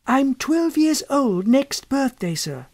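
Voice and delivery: lightly voiced schoolboy replying to his elder; polite young boy. A narrator's reading. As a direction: answer like a shy, well-mannered boy. The boy states his age.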